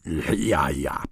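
throaty groan. Acted. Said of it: "ächzt ... mit fettem Räusperton".

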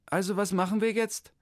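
high, chirpy clerk voice; the clerk rattles off her greeting, high; official lady. Acted. The town-hall clerk greets Hermann, high and chirpy.

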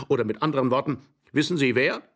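ordinary delivery

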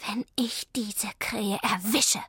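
a touch of a cat's hiss; mildly hissed warning; hissed threat. A narrator's reading. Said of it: "fauchte Zelda". (leicht fauchend)

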